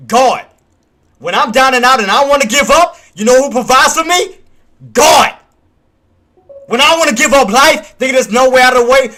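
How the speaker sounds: booming, preacher-style proclamation, each line punched hard; loud preaching rant. Real, not acted. Streamer preaching to his viewers, loud and fervent. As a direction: preach it at full force about God. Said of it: "sorry about the rant".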